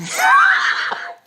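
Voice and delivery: sudden loud burst of laughter. Real, not acted. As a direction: cackle out loud at the joke.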